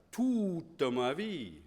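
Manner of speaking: "Toute" drawn out slowly with a pitch lift, lips rounded wide; exaggerated French. Shown as a demonstration. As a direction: stretch out "Toute ma vie" like a French lesson. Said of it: "big difference between toute and tu".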